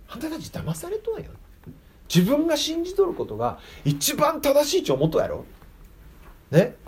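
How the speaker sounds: lightly voiced Jesus, hushed and high; gentle Jesus reproach. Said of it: "って。".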